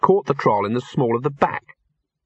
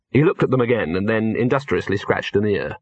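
ordinary delivery

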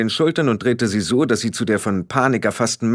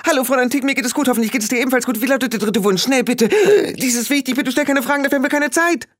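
high, rushed urgent plea; high breathless urgent calling; 12 semitones higher, faster than usual. Acted. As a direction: hurry her along breathlessly.

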